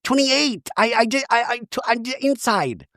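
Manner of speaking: high, halting put-on Shannon voice; flustered mock Shannon; Shannon impression. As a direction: stumble through it in a mock voice. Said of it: "And Shannon goes".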